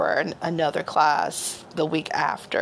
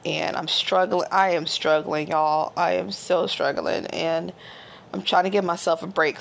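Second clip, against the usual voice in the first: usual speaking voice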